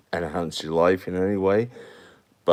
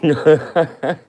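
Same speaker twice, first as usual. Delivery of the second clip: laughing at himself; 9 semitones higher, 7 dB louder than usual. Real, not acted. Laughs at own impression.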